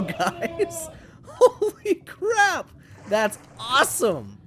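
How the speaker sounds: very high, gleeful squeal of triumph; thrilled outburst. Real, not acted. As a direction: burst out in real delight, pitch soaring. Celebrating a successful tame.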